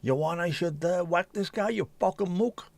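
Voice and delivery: high-pitched New York Italian-American wiseguy; hammed-up Italian-American tough-guy accent; mock Italian-American accent. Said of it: "I know the Italian Americans".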